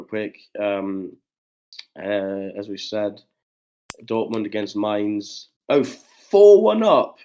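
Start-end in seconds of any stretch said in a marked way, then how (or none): 5.7-7.2 high, slow excited shout; thrilled burst at the scoreline. Real, not acted. Score update in live match.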